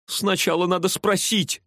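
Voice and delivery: high, insistent protest; objecting. A narrator's reading. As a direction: push back against the order. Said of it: "возразил парень".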